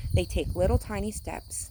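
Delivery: usual speaking voice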